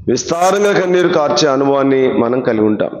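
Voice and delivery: ordinary talk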